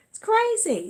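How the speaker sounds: high amazed exclaim; surprised. Real, not acted. Reacting to the phone going off, high amazed cry. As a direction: burst out with high disbelief.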